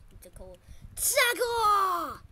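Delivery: loud, high attack call ending in a yell; attack shout. Real, not acted. Player unleashing electrical tackle, attack shout. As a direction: yell the move like a battle cry.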